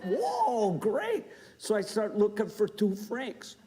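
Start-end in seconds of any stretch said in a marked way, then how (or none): 0.0-1.2 mock delight. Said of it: "I'm like".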